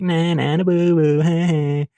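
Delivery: high mocking sing-song taunt; playful jeering; teasing. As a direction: tease her as she is led away. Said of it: "just teasing her".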